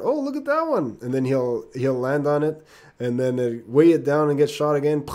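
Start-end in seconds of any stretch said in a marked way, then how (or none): 0.0-1.0 much higher, delighted fly voice; cartoon fly voice, thrilled; excited fly. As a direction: spot the new flower with glee. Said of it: "he'll see another purple flower".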